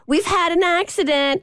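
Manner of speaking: polite call-out. Retold or imitated. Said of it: "I yell at the woman, um, kindly".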